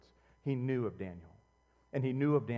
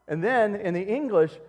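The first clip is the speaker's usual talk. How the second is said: normal talking voice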